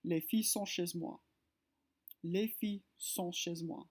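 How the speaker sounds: les filles sont chez moi, final S of les silent before filles; French sentence. Shown as a demonstration. Teacher reading the liaison example, French. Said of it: "the example right here".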